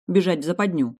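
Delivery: usual speaking voice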